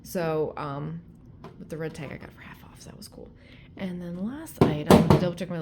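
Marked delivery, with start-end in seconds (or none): 1.6-3.3 soft confiding whisper. Real, not acted. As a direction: whisper it like a secret. Aside about the bargain price.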